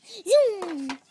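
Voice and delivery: high, loud whoosh word, falling off; zooming noise. Acted. Guard racing to a post, zooming noise. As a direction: zoom off with a drawn-out hum.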